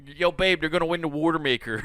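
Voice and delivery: put-on quick, cocky Philly guy, babe to the boys; lightly done Philly accent, bragging fast; Philly accent, a touch hammed-up; mock Philly accent. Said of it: "that dirty Philly accent".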